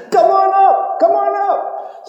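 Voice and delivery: very high beckoning call; Pope inviting. Acted. The Pope calling Bubba up to the balcony, very high. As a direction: wave him up, singing it.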